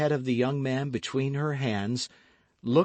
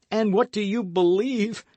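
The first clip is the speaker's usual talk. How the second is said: voice stifled by sobs; 10 semitones higher, 5 dB louder than usual. Acted. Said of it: "His voice was stifled by sobs".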